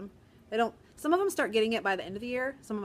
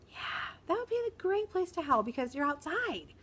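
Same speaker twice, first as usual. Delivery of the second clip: teacher voice; 9 semitones higher than usual. Retold or imitated. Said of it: "At recess?".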